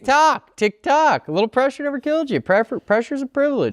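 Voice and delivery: high-pitched goading countdown; needling taunt. Real, not acted. Co-host heckling the searching host, high-pitched teasing. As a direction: pitch it way up to hurry him along.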